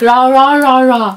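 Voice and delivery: loud mock row noises; playful imitation of people squabbling; mock arguing. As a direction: bark out a quarrel, hammed-up. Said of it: "the other person's arguing and it's like".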